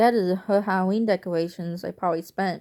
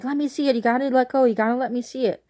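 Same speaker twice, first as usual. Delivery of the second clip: lightly voiced, higher grandma insisting; insistent grandma; 6 semitones higher, 4 dB louder, faster than usual. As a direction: coax it out of her, a bit higher. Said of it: "she's like".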